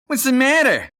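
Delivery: put-on a high, loud sneering kid bully; taunting bully. Billy the Bully taunts Johnny on his way home, high and jeering. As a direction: needle him like a schoolyard bully.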